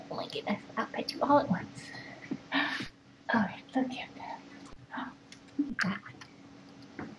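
hushed whisper to the little ones. Real, not acted. Whispering softly over the sleepy pets. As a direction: whisper so as not to startle them.

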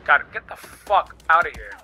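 ordinary talk, nothing marked

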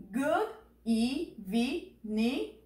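"Good evening" very slowly, "evening" split into three syllables. Shown as a demonstration. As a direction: spell out "Good evening" beat by beat. Teacher models the phrase slowly.

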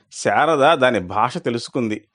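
usual speaking voice

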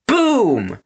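high, booming brute's yell. A narrator's reading. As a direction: yell it like a bat swing. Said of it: "yelled Gristle".

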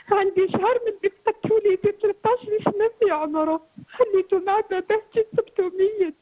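tearful plea through sobs; speaking while weeping for her son; crying. Real, not acted. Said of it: "هاد البكا اللي راكي تبكي".

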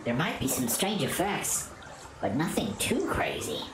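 low, calm ancient spirit; wise spirit voice. Acted.

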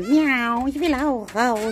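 high, cutesy voice for the cat; cat's voice. Pretend. Giving the cat a voice that wants to go outside, high and cute. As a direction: speak as the cat wanting out.

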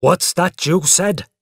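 loud, slow stern father; sharp parental challenge, each word weighed. A narrator's reading. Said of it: "he asked sternly".

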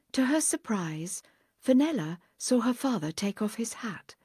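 ordinary delivery

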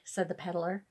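ordinary delivery

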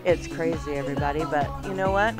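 usual speaking voice

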